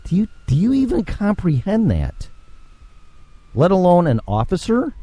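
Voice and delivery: high-pitched, incredulous scolding; exasperated disbelief. Acted. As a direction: demand an answer in exasperated disbelief. Ranting at reckless gun pullers.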